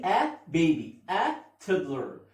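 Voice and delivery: life stages said slowly, word by word; slow review. Shown as a demonstration. Teacher slowly reviewing the life stages list. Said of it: "تاني".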